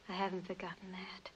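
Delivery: breathy whisper of gratitude; emotional whisper. Acted. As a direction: let it slip out in a whisper. Secretary confessing gratitude, overcome.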